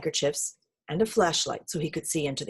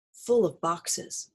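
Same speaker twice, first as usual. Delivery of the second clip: ordinary delivery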